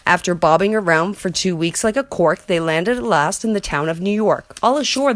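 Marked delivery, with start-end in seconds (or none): 4.6-5.1 high call of all ashore; shout. A narrator's reading. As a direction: call to the dock. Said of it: "the men shouted".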